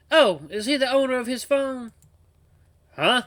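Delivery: high, put-on southern voice; southern accent. Grandpa disguising his voice on the phone, southern accent. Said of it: "He lowered his voice and used a southern ac".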